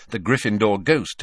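ordinary delivery, nothing marked

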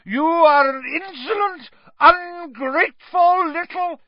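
loud high rage; Aunt Marge, furious. A narrator's reading. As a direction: spit the insults in fury. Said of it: "swelling with fury".

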